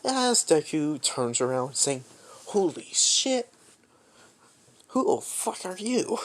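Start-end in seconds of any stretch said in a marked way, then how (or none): 2.5-6.2 higher, shocked outburst; startled yelp. Acted. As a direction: jump at the sudden voice behind you. Deku startled by villain.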